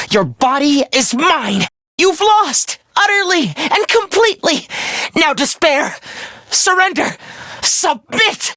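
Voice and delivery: high, mounting villainous triumph; triumphant command. Acted. Villain ordering Takumi to surrender his body, triumphant.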